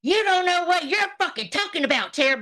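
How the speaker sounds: high-pitched mocking imitation; mock quote of rival. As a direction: throw her words back, pitched high. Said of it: "over there saying".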